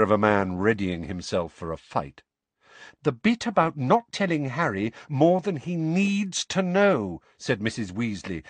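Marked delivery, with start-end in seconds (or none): 3.0-7.2 "needs to know" weighted heavily; emphatic mother; stress on last three words. A narrator's reading. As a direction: lean hard on "he needs to know". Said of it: "heavy emphasis on the last three words".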